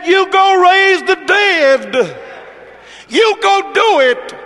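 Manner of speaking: high, drawn-out preacher's cry; impassioned preached command. Retold or imitated. Preacher voicing Jesus sending disciples to raise the dead, ringing cry.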